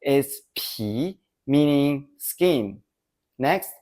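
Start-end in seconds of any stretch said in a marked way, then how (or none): 0.6-1.2 "pi" rising in pitch; second tone. Shown as a demonstration. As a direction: Mandarin rising tone on "pi". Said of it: "With the second tone".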